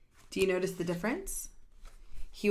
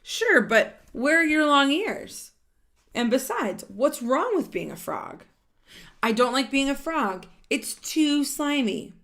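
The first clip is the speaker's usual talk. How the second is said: louder, lively read-aloud of a character line; animated storybook voice; 4 semitones higher, 7 dB louder than usual. Acted. Reading a picture book to children, animated and louder. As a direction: voice the characters brightly for the kids.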